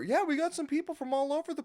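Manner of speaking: high, put-on host voice; imitated announcer. Announcer telling the stream about the crowd, imitated high voice. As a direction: mimic a host in a high pitch.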